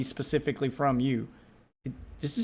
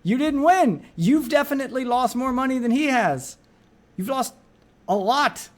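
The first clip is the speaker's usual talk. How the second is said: higher, insistent protest; exasperated rebuttal; 6 semitones higher than usual. Real, not acted. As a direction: argue back at him in raised pitch. Argues back at the video maker.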